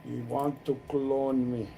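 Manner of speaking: talking round a cigarette; words past a cigarette in mouth. Real, not acted. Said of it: "Why have I got a cigarette in my mouth?".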